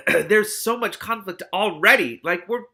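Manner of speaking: high, loud exclamation, dragged out; exasperated excitement. Real, not acted. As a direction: squeal at how much drama there is already. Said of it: "Oh my god".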